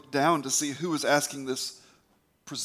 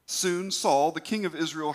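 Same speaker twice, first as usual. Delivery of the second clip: ordinary delivery, nothing marked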